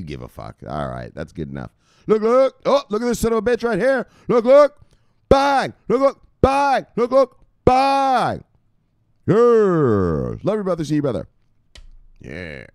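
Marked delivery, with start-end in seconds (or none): 2.0-11.3 very high, loud hype shouting; frantic high-pitched hype bursts; hyped shout-out. Pretend. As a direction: hammer out catchphrases at full blast. Calling out shout-outs to followers.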